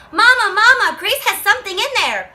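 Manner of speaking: high, loud call for Mama; a child calling her mother. Retold or imitated. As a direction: shout for Mama like an alarmed kid.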